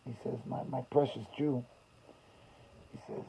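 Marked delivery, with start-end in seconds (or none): 0.5-1.6 very soft, halting, imitating tears; hushed and haltingly tender; barely able to get the words out; retold breakdown. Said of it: "just breaks down and could barely talk".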